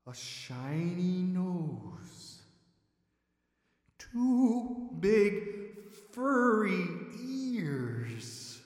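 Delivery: soft, slow suspense; hushed suspense. A narrator's reading. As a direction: draw out each detail slowly. Slowly discovering the bear.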